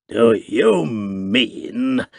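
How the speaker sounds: very slow, rasping, as if forgotten how to speak; raucous undertone. A narrator's reading. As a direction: drag the words out stiffly. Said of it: "spoke in a raucous undertone".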